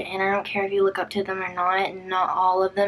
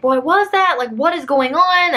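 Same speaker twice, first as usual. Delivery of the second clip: high, louder bewildered questions; retold alarm, pitched up; alarmed questioning; 7 semitones higher, 4 dB louder than usual. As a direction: imitate a friend demanding to know what happened. Acts out alarmed friend on FaceTime.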